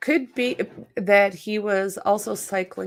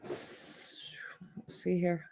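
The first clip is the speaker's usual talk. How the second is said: whisper; 2 semitones lower, 13 dB quieter than usual. Real, not acted. Muttering to herself while checking.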